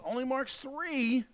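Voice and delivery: slow, rising announcer call; drawn-out call. Real, not acted. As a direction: stretch the words out high. Commentator drawing out a call.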